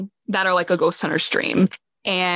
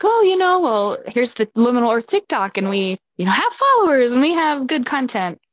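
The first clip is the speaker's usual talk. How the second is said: high, bright, eager sales pitch; 6 semitones higher than usual. Retold or imitated. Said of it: "prepared to be like".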